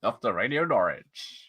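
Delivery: slow, lightly voiced radio DJ; a hint of a smug local DJ; Alan Partridge voice. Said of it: "It's Alan Partridge is here".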